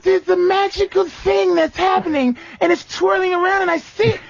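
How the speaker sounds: mock mystical woman. Mocking a woman who claims she feels magic. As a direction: ham up a swooning woman seeing magic. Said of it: "if she starts saying to me".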